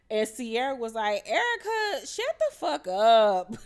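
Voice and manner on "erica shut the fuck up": high shrill yell of the name, then a slow loud curse; imitating a friend yelling at another mid-fight; angry retort. Sierra snaps at Erica mid-argument.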